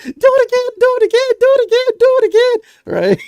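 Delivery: very high, loud chant; begging kid. Acted. Kid demanding another dance from bastion, squealing chant. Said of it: "He's like".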